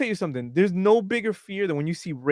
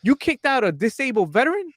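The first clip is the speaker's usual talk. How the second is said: higher-pitched incredulous challenge; indignant hype man; 7 semitones higher than usual. Retold or imitated. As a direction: voice climbing in mock outrage.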